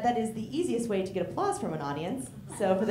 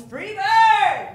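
put-on drunk yell; 11 semitones higher, 10 dB louder than usual. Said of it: "someone in the crowd behind me yells".

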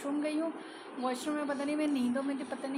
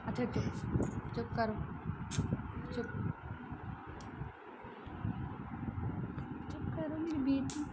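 soothing; 8 dB quieter than usual. Real, not acted. Soothing crying daughter.

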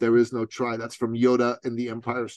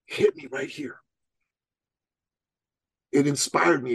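usual speaking voice

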